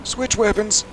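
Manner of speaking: urgent shout. Real, not acted. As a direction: yell the order fast. Urgent command during fight.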